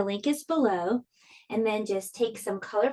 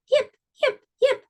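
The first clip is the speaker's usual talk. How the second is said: very high, squeaky fox yips; high little fox calling into the night. Acted. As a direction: yelp like a tiny fox calling out. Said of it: "She called".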